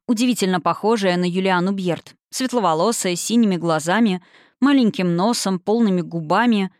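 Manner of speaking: plain reading voice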